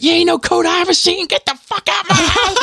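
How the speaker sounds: extremely high, hammed-up mother voice; drunk mother's screech. Drunk mother to the boy posing as a coat, high screeching impression. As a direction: imitate her screeching the intruder out. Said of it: "And she was like".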